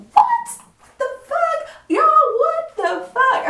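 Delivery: high, loud disbelieving shriek; a stunned yelp that climbs, then stretches slowly; shocked outburst. Retold or imitated. Disbelieving shriek at the cop's news on the phone. Told cops arrested boyfriend, car towed.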